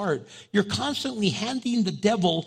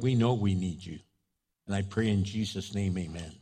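earnest prayer; 6 semitones lower, 3 dB quieter than usual. Real, not acted. Pastor praying aloud to God, hushed and earnest. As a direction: lead the congregation in prayer.

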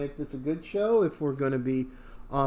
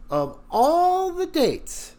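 exasperated; 13 semitones higher, 3 dB louder, slower than usual. Real, not acted. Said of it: "exasperated emphasis on court date".

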